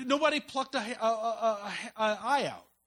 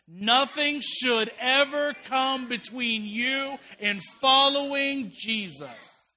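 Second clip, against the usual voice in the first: drawn-out loud chant, everyone together; loud group recitation; 3 semitones higher, 8 dB louder, much slower than usual. Shown as a demonstration. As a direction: shout it slowly with the whole congregation. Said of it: "say that really loud with me".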